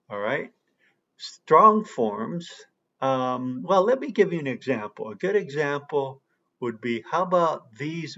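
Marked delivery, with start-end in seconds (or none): none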